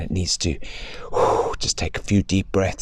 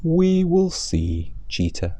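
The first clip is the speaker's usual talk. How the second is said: calm tortoise; 9 semitones higher, slower than usual. Acted. Tortoise answering the boastful cheetah, slow and calm. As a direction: take your time, unbothered. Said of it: "he said".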